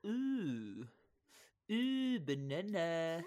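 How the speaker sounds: slow, breathy cooing voice; soft cooing banana. Pretend. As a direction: coo it out slowly. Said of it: "banana would be like".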